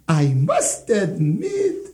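ordinary delivery

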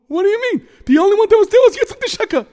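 high, loud disbelief; outraged shout. Acted. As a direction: protest loudly in shock.

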